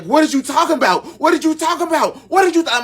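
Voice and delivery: dad yelling. Retold or imitated. Dad furious at the news.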